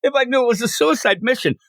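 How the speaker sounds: hammed-up indignation; upset Peacemaker. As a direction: protest like a wounded kid. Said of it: "he's all upset".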